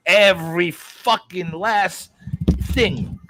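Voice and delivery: every word slow and hammered out; slow, deliberate insistence; drawn-out emphasis. Real, not acted. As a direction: stretch "Every fucking last thing" slowly for emphasis. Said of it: "you hear me?".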